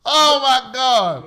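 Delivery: slow, high, loud drawn-out gasp of words; astonished. Real, not acted. Host reacting to his friend's story, slow astonished oh my God. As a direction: stretch it out in disbelief.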